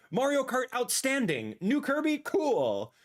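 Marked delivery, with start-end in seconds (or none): none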